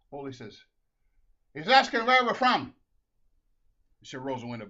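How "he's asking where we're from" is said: loud, raised-pitch answer; shouted relay. Acted. Husband reports to wife in the car, loud high shout. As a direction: shout it back to her.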